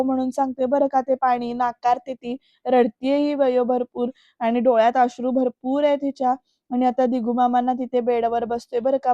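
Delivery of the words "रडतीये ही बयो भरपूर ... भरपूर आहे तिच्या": normal talking voice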